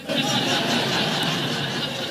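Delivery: a quiet airy chuckle, barely voiced; sheepish breathy chuckle; soft breathy laugh. Real, not acted. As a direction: laugh it off at your own expense. Laughs at the woman's remark.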